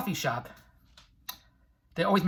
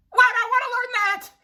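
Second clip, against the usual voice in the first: squeaky high mocking question; shrill sarcastic squeal at a small goal; mock disbelief; 5 dB louder than usual. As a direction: scoff at the idea in a silly high voice. Mocking the hundred-thousand crowd.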